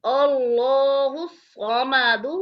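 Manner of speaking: drawn-out chanted recitation; slow Quranic recitation. Shown as a demonstration. Quran teacher reciting slowly for students. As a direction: recite it slowly, melodic and even. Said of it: "teacher demonstrates Quran recitation".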